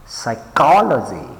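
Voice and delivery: psychology with COL punched, then falling; psy-COL-ogy, leaning on COL; stress on second syllable. Shown as a demonstration. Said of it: "pay attention to the stress syllables".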